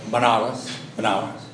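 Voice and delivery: "Banaras" as ba-NA-ras, middle syllable stressed; as English heard it. Shown as a demonstration. Teacher showing what the English heard, ba-NA-ras for "Banaras". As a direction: say "Banaras" with open A in all three syllables. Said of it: "como escuchaban los ingleses".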